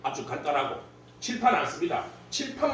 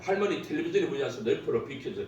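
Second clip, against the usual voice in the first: rushed long request; 6 semitones lower, 4 dB quieter, faster than usual. Shown as a demonstration. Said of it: "소리로 끝납니다".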